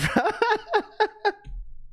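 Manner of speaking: a long high squealing laugh; laughing fit; high laughing burst. Real, not acted. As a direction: let out a helpless high laugh. Laughing fit after the story.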